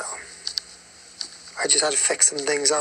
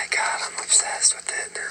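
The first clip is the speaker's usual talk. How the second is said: breathy whispered gush of delight; real whisper; 4 dB quieter than usual. Real, not acted. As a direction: whisper it like a secret crush. Whispered gush over song.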